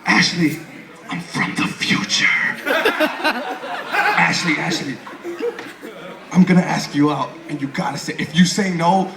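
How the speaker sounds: hammed-up, high breathy doom prophecy; dramatic time traveler. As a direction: sell the end of the world, laughing through it. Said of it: "he's like".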